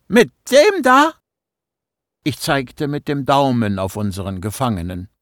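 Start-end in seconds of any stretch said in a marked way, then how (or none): 0.1-1.1 very high, loud, cracking disbelief. Acted. Boy points at the captive, voice cracking. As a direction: squeak it out in horror. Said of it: "Meine Stimme überschlug sich".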